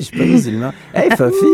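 high, loud mock Mexican accent. Teasing his co-host with a loud, high mock Mexican accent. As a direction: redo the line in a hammed-up Mexican accent. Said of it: "Vas-y, refais".

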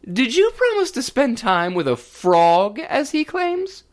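a king demanding an answer; stern king. Acted. King questions his daughter at dinner, loud and demanding.

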